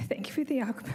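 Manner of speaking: choking up mid-sentence, words breaking off; voice catching. Real, not acted. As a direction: start to speak, then let emotion cut you off.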